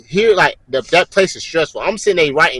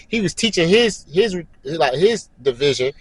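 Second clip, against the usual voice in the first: ordinary talk, nothing marked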